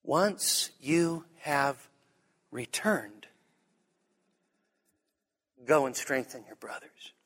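soft, low and very slow; gentle correction. Acted. Jesus telling Simon what follows his return, hushed and slow. True verse after two triumphant misquotes.

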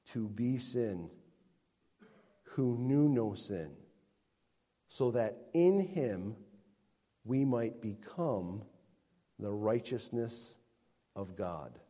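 slow scripture reading with long pauses; measured, weighty verse reading; deliberately slow. Real, not acted. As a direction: read it phrase by phrase, unhurried. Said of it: "I'm gonna say this slowly".

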